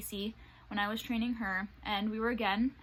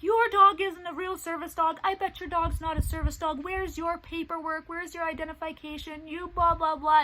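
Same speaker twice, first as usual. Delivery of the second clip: higher, louder mocking rant at a stranger; mock angry yell; 7 semitones higher, 5 dB louder than usual. Man yelling at her in the parking lot, mock angry rant. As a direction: imitate a man yelling accusations, hammed up. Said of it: "yelling at me".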